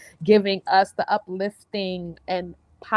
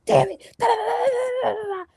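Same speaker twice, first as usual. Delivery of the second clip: shouting in frustration, much higher; frustrated shout; much faster than usual. Retold or imitated. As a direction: scream it out in frustration. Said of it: "I be screaming, I be yelling".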